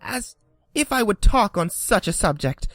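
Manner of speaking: a creature trembling with hate of cats; trembling angry mouse. A narrator's reading. The mouse rails at Alice about cats. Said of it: "cried the mouse, who was trembling".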